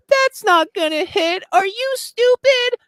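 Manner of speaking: very high, slow squawk of disbelief; mock outrage. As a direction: imitate scandalized commenters in a drawn-out high voice. Acts outraged commenters reacting to picks.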